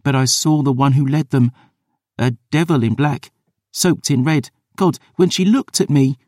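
put-on a girl haunted by what she saw; small, scared girl voice; frightened girl. Girl's voice.

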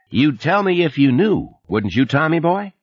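a chummy Texan supervisor on the phone; Texas twang. Acted. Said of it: "the familiar voice with the Texas twang".

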